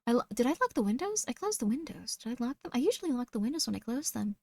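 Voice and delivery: soft, self-doubting murmur; acting out worried thoughts under the breath; quietly fretting to oneself; anxious inner thoughts. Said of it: "takes you out of your thoughts".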